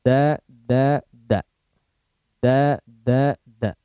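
"Da, da" with tongue tip on the upper front teeth; teacher models "da" for the class, crisp and clear; demonstrating dal. Shown as a demonstration. Teacher showing students the Arabic dal, crisp demonstration. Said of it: "Itu huruf dal".

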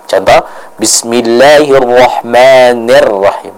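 Bismillahirrahmanirrahim recited slowly, ni with a full i; clear ni sound. Shown as a demonstration. Teacher demonstrating the correct ni to Ibu Yani, slow recitation. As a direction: stretch Bismillahirrahmanirrahim, ni never ne. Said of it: "Jadi dibaca dengan ni, bukan ne".

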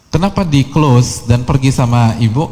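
ordinary delivery

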